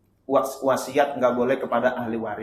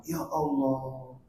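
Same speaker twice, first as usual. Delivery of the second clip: slow, drawn-out softer lament; acted dismay; 5 dB quieter, much slower than usual. Woman hearing her lover has died, dismayed. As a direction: let it sink in slowly and softly.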